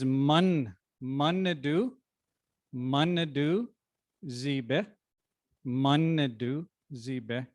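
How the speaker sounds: "Munadu Zibe" sounded out slowly, piece by piece; pronunciation demo. Shown as a demonstration. Speaker teaching the audience the book's name, slow careful pronunciation. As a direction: teach "Munadu Zibe" with MUN first, each part drawn out. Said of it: "how to pronounce the name of the book correctly".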